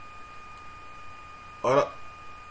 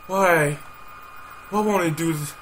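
ordinary delivery

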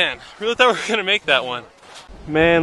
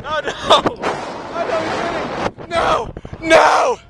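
dismayed shout; 9 semitones higher, slower than usual. Real, not acted. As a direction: yell in mock despair. Losing the race.

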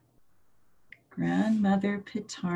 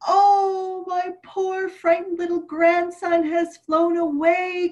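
high, mock-tearful lament; hammed-up grief for a lost grandson; grandma crying out in sorrow; wailing grandma; 9 semitones higher than usual. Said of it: "Grandma cried".

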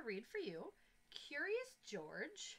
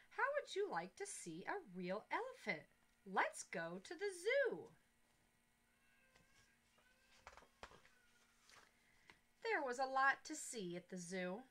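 put-on reading to children; 4 dB louder than usual. A narrator's reading. Teacher reads story aloud.

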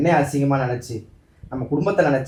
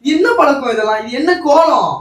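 louder, higher scolding outburst; exasperated scolding; 9 semitones higher, 6 dB louder than usual. Acted. Husband scolds his wife over her outfit, indignant and loud. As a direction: demand an answer, indignant.

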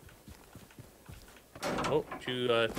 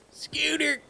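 high excited squeal; 13 semitones higher than usual. Pretend. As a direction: toss it out in a high playful squeal. Rhyme word shouted high.

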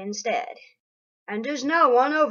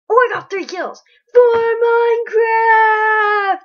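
high, loud, slow hammed-up cheer; mock Minecraft voice; 11 semitones higher, 13 dB louder, slower than usual. Imitating what Minecraft would say, hammed-up high shout. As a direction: shout it like a silly game character. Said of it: "as Minecraft would say".

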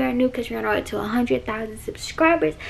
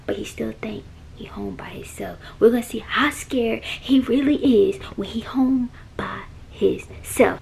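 whispering. Real, not acted. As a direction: whisper from the closet. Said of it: "why I'm whispering".

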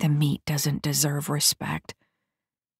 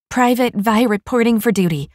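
put-on bright raised young recruit voice; eager report; 6 semitones higher, 5 dB louder than usual. A narrator's reading. New private reporting to her corporals, eager and loud. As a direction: snap to attention and report in, louder.

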